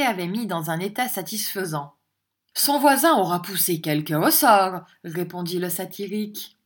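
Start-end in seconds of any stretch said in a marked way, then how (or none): none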